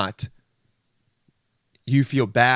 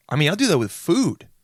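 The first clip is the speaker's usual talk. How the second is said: high, punched emphasis on "food"; over-emphatic outburst; yelling; 5 semitones higher than usual. Real, not acted. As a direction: blurt it out, with "food" leaping up in pitch. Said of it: "Why am I yelling?".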